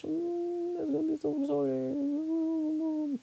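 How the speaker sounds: soft, high, slow squeal of delight; gushing anticipation. Real, not acted. Streamer gushes over dog meeting baby, high soft squeal. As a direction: coo it high and drawn out.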